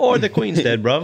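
high, loud hammed-up British bloke; mock British accent. Joking about a fake Brit at the ferry, in a hammed-up British accent. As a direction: ham up a Cockney "bruv" for laughs.

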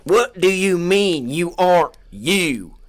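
high, loud mock interrogator; loud interviewer act; hammed-up interviewer. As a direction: press him hard. Interviewer role.

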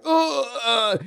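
sudden high grunt, like taking a punch; a short hammed-up pained grunt; mock grunt of pain. Retelling the pilot getting punched, a mock grunt.

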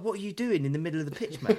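retold exasperated challenge. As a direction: re-enact a referee's baffled comeback. Retold ref repeats challenge to goalkeeper.

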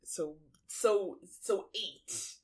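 ordinary talk

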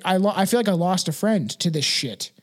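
usual speaking voice

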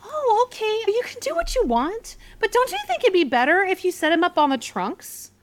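very high, squeaky mock politeness. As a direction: imitate a pushy squeaky-voiced woman. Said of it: "little Miss Squeaky Voice".

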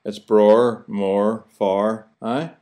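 bror, mor, far with the th dropped, Belfast style; Belfast pronunciation. Shown as a demonstration. Host showing listeners Belfast words, dropped th sounds. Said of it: "we don't say other or brother or father or mother".

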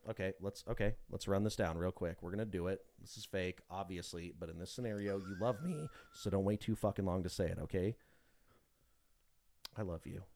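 mock scene setup. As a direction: murmur the setup like a director. Acting out a fake love scene.

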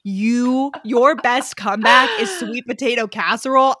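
ordinary delivery

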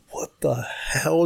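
slow, hushed disbelief; stunned whisper. Retold or imitated. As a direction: whisper it slowly, astonished. Stunned reaction to heroin remark.

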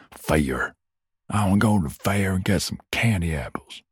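a lightly voiced southern US accent. Shown as a demonstration. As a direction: play a Southern kid begging for the fair. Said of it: "in a southern U S accent".

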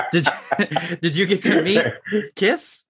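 giggling through the question, rising high on Kiss; laughing question. Real, not acted. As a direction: ask it while laughing. Host laughing while asking.

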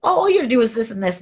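quick, offhand mimicry; mock airy, dismissive caseworker; breezy and light. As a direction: rattle it off as if it's no trouble. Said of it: "his words were so breezy and light".

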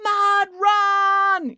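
high, stretched two-word chant; crowd yell. A narrator's reading. Gathered residents answering Brad, yelling. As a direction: chant it as one excited crowd. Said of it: "yelled all the gathered residents".

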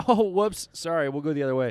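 overdone phony apology; exaggerated apology. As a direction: fake an innocent oops for others to hear. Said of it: "if you could really exaggerate it".

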